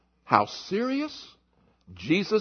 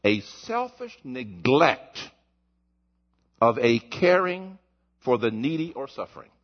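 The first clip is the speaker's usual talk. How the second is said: very slow, deliberate reading; slow emphatic reading; 8 semitones lower than usual. Real, not acted. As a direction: lean on each word. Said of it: "Now watch this one".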